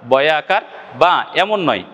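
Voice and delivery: ordinary delivery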